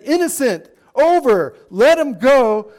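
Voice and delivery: high, forceful dismissal; decisive ruling. Retold or imitated. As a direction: proclaim each short phrase firmly. Acts out Pilate's decisive ruling.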